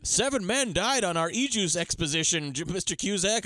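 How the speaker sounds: hammed-up protest from an expedition man; alarmed underling. Miner reporting deaths to Mister Cusack, mock alarm.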